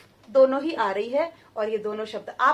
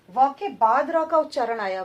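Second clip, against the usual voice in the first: ordinary talk, nothing marked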